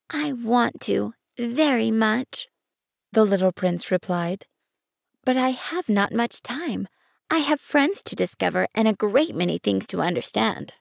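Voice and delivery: a young boy wanting it badly but pressed for time; earnest little prince. Prince voice held over several lines.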